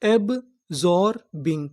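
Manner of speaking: Absorbing drawn out syllable by syllable; slow sounding out. Shown as a demonstration. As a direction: stretch absorbing slowly for the class. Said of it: "पूरी meaning क्या बनी?".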